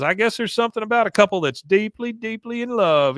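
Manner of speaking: high, lovestruck mock gushing; gushing suitor. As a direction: stretch it out as a smitten braggart. Bill gushes about his romance.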